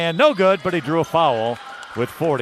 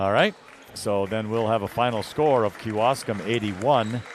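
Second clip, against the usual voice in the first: usual speaking voice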